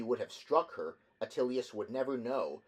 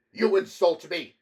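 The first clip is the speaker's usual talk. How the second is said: high, loud outraged cry; furious rich man shouting at the engineer; 8 semitones higher, 14 dB louder than usual. A narrator's reading. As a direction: explode at the insult. Ampliatus blocks way, furious.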